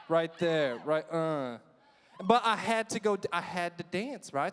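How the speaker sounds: ordinary delivery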